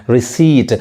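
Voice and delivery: रिसीट said as "ri-seet"; long ee and silent p in रिसीट; correct pronunciation. Shown as a demonstration. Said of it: "रिसीट पढ़ेंगे".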